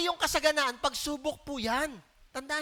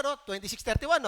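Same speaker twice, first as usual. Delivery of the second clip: ordinary talk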